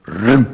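loud rolled whoosh of blurred words; one quick rumbling burst of mock speech; imitated rapid speech. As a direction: mimic a sentence racing past unintelligibly. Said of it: "So schnell wird gesprochen".

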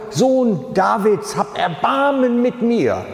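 hoher, gedehnter Hilferuf; lauter Ruf. Retold or imitated. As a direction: ruf noch eindringlicher als zuvor, langsam. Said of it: "Doch er rief nur umso lauter". (hoch und gedehnt rufend)

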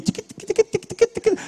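rapid ticking guitar noises; imitated guitar picking. Churchgoer mocking the guitarist's playing, chattering guitar imitation. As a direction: mimic busy guitar licks with the tongue. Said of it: "tava com a guitarra parecendo o [name]".

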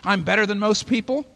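loud, high mock complaint, roared; mock roaring. Preacher mimics people roaring about their lives, loudly. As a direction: roar it like a self-pitying complainer. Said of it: "running around roaring and saying".

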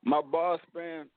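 ordinary talk, nothing marked

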